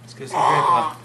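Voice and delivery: loud eager call. Real, not acted. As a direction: call it out across the room. Repeat called out loudly.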